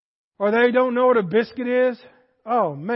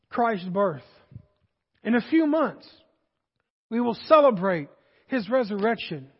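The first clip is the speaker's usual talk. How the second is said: ordinary delivery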